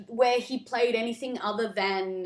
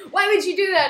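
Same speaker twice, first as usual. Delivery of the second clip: high, loud, exasperated question; playful outraged demand, pitched up; mock scolding; 8 semitones higher, 7 dB louder than usual. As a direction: scold your partner in a hammed-up way. Acted scolding of a partner.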